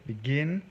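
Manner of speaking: begin, with the weight on its last syllable, gin; stress on second syllable. Shown as a demonstration. Teacher showing the class the accented syllable, begin stressed on gin. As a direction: lean on the GIN in begin, rising. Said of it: "si c'est la syllabe accentuée".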